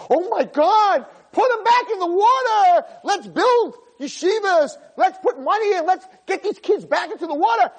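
high, shouted urgent rallying; alarmed plea. Acted. Rabbi voices listeners urging rescue of kids, high urgent shouting. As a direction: cry it out like a rescue call. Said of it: "sitting in your chair and saying".

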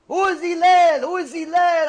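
high, loud demanding shout; screaming caller. Retold or imitated. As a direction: yell it as if he's a total stranger. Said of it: "He's screaming like he never saw him before".

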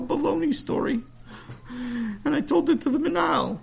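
put-on high, indignant little boy; a kid tattling on his teacher, high-pitched; young boy voice. Sammy voiced by narrator.

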